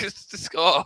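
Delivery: ordinary delivery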